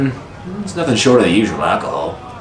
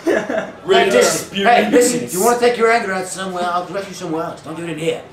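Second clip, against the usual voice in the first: high-pitched barkeep; 10 semitones higher than usual. Acted. Barkeep scolds a brawler.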